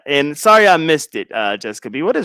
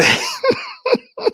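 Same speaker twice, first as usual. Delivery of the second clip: high squealing laugh; 4 dB quieter than usual. Real, not acted. Laughing at own joy.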